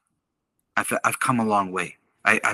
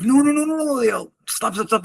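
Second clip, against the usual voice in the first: panicked pleading; 14 semitones higher than usual. Retold or imitated. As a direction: beg him to stop in mock panic, voice shooting up. Guys panicking as he charges.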